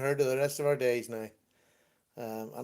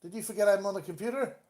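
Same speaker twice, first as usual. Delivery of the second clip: normal talking voice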